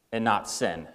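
usual speaking voice

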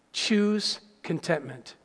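low, soft, slow statement; hushed, measured advice; quiet key point. Real, not acted. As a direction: state it gently, low and unhurried. Key point stated, then repeated.

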